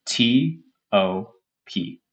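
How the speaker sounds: slow, deliberate spelling of T O P; spelled out slowly. Shown as a demonstration. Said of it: "In this case".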